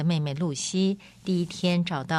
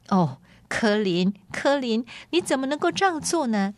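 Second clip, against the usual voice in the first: high-pitched, dismayed scolding of a dear friend; dismayed reproach; 6 semitones higher than usual. Acted. Lady to the prince she takes for her runaway friend, dismayed reproach. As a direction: reproach him in a raised pitch, quickening into the question.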